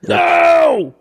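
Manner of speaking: high shouted no. Acted. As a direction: yell it in mock shock. Fake outrage at gender reveal.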